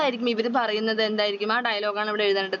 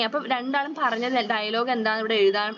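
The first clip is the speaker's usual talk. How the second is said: ordinary talk, nothing marked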